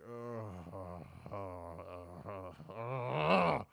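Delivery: low, soft drawn-out ugh groans; fed-up moaning ughs; exasperated groans. Retold or imitated. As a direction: groan like you're worn out. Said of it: "I would be like".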